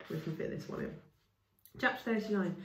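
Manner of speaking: ordinary delivery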